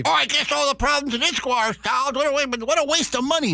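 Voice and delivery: high-pitched mock whining complaint; outraged online commenter. Radio host mimicking angry comment-section readers, mock outrage. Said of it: "People lost their minds".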